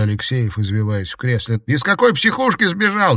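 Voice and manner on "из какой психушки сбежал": high, loud frantic yell. Acted. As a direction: shout in panic. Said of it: "Не ори". (крик)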